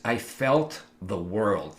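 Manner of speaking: drawn out slowly with weight on felt; slow it right down on "felt"; slow, heavy repeat. Real, not acted. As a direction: let "I felt the world" sink in slowly.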